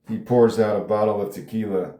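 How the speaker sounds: normal talking voice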